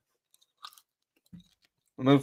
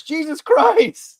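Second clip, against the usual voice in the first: mock exclamation; 12 semitones higher, 5 dB louder, much slower than usual.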